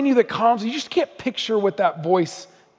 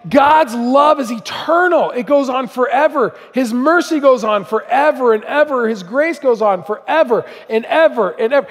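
preaching; 4 semitones higher than usual. Acted. Preacher urging the congregation about God's endless love, fervently. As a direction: drive it home like a revival preacher.

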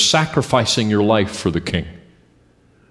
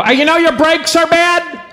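high, loud call from a car window; passerby yelling; 4 dB louder than usual. Retold or imitated. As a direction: holler it at a passing driver. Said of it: "roll their window down and go".

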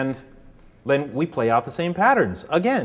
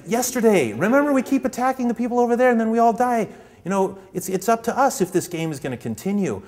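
higher, urgent plea to remember; urgent pawn; 9 semitones higher than usual. Retold or imitated. Pawn urging the others that the game can stop, urgent and high. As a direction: shake them awake with the story.

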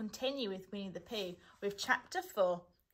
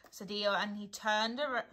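ordinary delivery, nothing marked

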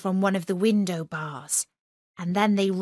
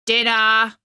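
louder calling guard; called out; 2 semitones higher, 7 dB louder than usual. A narrator's reading. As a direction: call it down through the hatch. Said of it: "called out".

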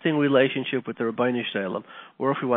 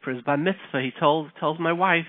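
ordinary delivery

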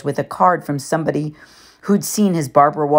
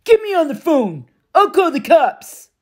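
threatening Gabe; 13 semitones higher, 3 dB louder than usual. A narrator's reading. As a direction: threaten to call the cops. Gabe voiced in narration.

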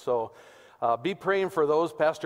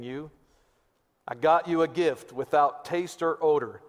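put-on Pastor Weaver's Texas accent; 3 semitones higher, 3 dB louder than usual. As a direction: recite a comic valentine in broad Texan, rising on each new verse. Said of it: "This is in my best Pastor Weaver, Texas accent voice".

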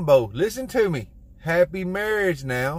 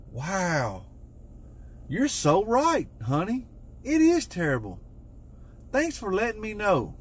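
mock grateful wife; 5 semitones higher, 3 dB quieter than usual. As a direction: play the wife thanking him too sweetly. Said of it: "she gonna be like".

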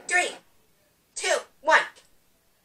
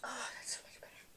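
barely audible, very soft; relieved whisper; 2 semitones lower, 21 dB quieter than usual. Real, not acted. Girl recovering from the sour candy, relieved whisper. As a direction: breathe it out in a whisper.

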